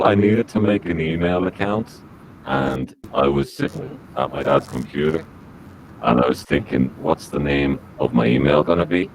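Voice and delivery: ordinary talk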